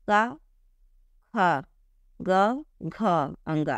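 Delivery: slow, separated syllable drill. Shown as a demonstration. Teacher models the syllable drill for students, clearly separated. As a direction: say का ख ग घ अंगा one by one. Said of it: "अब आप बोल के प्रैक्टिस कीजिए".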